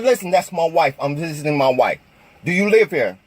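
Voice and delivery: calm, steady and polite. Retold or imitated. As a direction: keep it level and patient. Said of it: "And I'm talking calmly".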